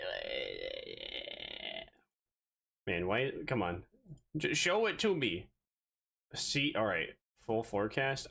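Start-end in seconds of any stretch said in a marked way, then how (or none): 0.0-1.9 very low, slow, quiet growl; monster growl. Pretend. Streamer growling at the weather app like a monster. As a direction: drag it out like a demon voice.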